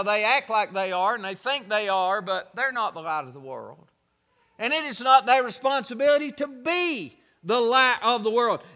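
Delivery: ordinary talk, nothing marked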